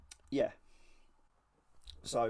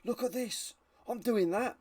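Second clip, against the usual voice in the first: higher, gushing mock excitement; excited reenactor; 7 semitones higher than usual. Reenactors find this and go, mock excited. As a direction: act out an over-keen hobbyist's discovery. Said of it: "reenactors find this and go".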